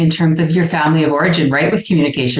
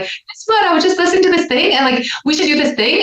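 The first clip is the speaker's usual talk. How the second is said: much higher, fast, bursting with excitement; hyper morning bunny; 12 semitones higher than usual. Retold or imitated. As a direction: bounce in like a hyper bunny with news. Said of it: "I'm so hyper".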